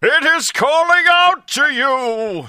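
high, slow booming character read; slow, loud, high theatrical read; hammed-up forum voice. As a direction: boom it out like a grand wizard. Reading a forum post in character.

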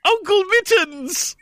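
very high, slow, far squeak; cartoon falsetto cry; yelling. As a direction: stretch out a silly high yell. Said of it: "Harry yelled".

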